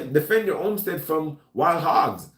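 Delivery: ordinary talk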